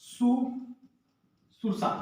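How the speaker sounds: सुसुरसा drawn out very slowly and softly; slow soft spelled-out word. Shown as a demonstration. Teacher dictating the correct word to students, slow and soft. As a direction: stretch सुसुरसा syllable by syllable, softly. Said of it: "तो क्या हो जाएगा?".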